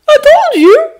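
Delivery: put-on very high, loud boyish pride; high, loud, overflowing pride; gushing. A narrator's reading. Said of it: "when Peter laughs and gushes".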